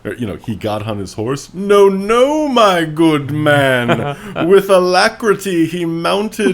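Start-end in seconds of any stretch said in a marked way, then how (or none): 1.5-6.5 much higher, louder, drawn-out pompous fop; pompous gentleman. Pretend. Mocking a flowery fantasy novel, high hammed-up pompous noble voice. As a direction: ham up a grandiose old-fashioned noble.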